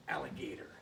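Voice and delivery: alligator breathed out in a whisper; soft whispered alligator on the smack. Shown as a demonstration. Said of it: "alligator smack it".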